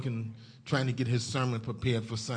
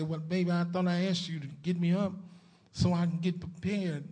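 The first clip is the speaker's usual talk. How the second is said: higher-pitched, mildly put-out complaint; mildly upset; 6 semitones higher than usual. Retold or imitated. Husband to wife who didn't wake him, mildly upset. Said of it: "he was kinda upset".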